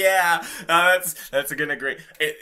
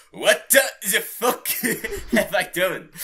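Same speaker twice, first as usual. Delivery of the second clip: loud laughing disbelief, higher; redone shock; 8 semitones higher, 6 dB louder, slower than usual. Shown as a demonstration. Co-host redoing the line correctly, loud laughing disbelief. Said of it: "waiting for you to get that right".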